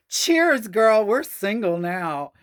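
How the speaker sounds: high, loud mock toast; mock cheers. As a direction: raise a glass with fake glee. [name] toasting mockingly.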